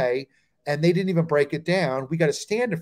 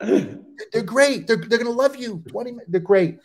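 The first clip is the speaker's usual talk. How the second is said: higher-pitched, keen encouragement; eager reassurance, retold; 6 semitones higher than usual. Young comic reassuring the headliner, eager and higher. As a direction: sell it eagerly to the doubter.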